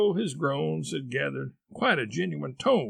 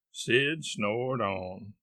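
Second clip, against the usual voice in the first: plain reading voice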